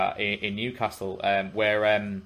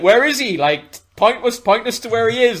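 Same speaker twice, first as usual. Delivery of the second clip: excited; 12 semitones higher, 10 dB louder than usual. Retold or imitated. Crowd asking excitedly.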